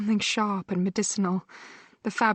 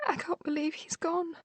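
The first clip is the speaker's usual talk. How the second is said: grief-stricken; 9 semitones higher, 5 dB quieter than usual. Acted. Sister grieving in hospital.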